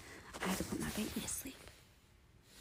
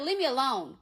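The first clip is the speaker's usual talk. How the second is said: snapped out louder and higher; angry brush-off; 6 semitones higher, 7 dB louder than usual. Retold or imitated. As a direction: shut him down hard, then hang up. Telling off the ex on phone.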